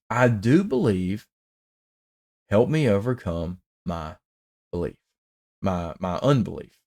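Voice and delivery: hushed re-reading of the plea. Shown as a demonstration. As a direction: drop to a soft, hushed voice for the plea. Re-reads the plea after "wow.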